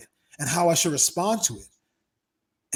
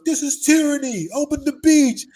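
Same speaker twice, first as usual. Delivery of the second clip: much higher, louder chant of protesters; mock protest shout; 11 semitones higher, 9 dB louder than usual. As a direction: yell it like a lockdown protester. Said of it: "they're like".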